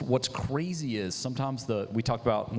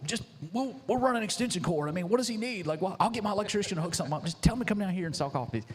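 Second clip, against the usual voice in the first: much higher, quick breezy Ty voice; imitating Ty; 9 semitones higher, faster than usual. Retelling Ty offering power to the food truck guy, high breezy voice. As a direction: toss it off like easygoing Ty. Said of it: "you know how Ty is".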